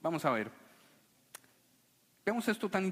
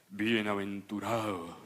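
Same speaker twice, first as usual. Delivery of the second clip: low, slow, deep pulpit voice; mock solemn preacher; 10 semitones lower than usual. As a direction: drag it out like a grave sermon. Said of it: "no se paraba al frente de toda una congregación y decía".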